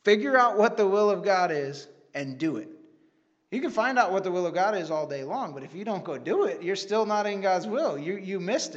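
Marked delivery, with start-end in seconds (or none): 0.0-4.5 louder, punchy pastor's pulpit line; repeating the old pastor's sermon line louder; quoting the pastor preaching. Retold or imitated. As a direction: hit "Figure out what the will of God is and do it" like the pastor preaching. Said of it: "when he would preach".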